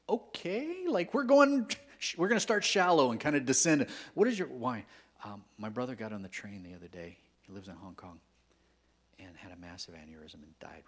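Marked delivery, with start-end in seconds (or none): 0.1-4.9 excited outburst. Retold or imitated. Reenacting the friend's hard question.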